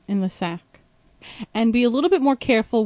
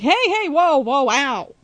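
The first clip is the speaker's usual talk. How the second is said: imitating a pained yelp; mock alarm; 3 semitones higher, slower than usual. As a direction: act out a sudden pinch. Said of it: "you're like".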